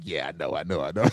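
low, soft, offhand mumble; imitating son's mumble. Dad imitates his son shrugging off the teasing, low mumble. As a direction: mimic a son brushing it off quietly. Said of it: "he's just like".

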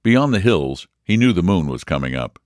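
usual speaking voice

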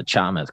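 Chamath said CHAH-muth, stress up front; tentative name pronunciation. Real, not acted. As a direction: say Chamath with a ch and first-syllable stress. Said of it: "I'm sorry if I don't say it right".

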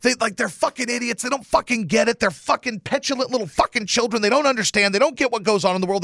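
high, heated rant at the haters; retold angry yelling. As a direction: rail against them, pitched well up and pushing harder. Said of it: "I'm yelling about it".

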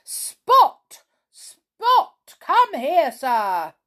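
high, loud shout after a dog; furious calling, starting very high and falling; angry shout. A narrator's reading. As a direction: call the dog sharply to heel. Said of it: "there came an angry shout".

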